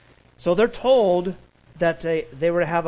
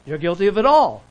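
much louder, hitting "all"; emphatic; 4 semitones higher, 10 dB louder than usual. Real, not acted. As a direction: thunder the verdict at the congregation. Preacher drives the point home.

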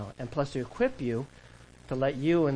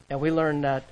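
ordinary talk

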